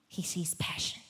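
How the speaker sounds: usual speaking voice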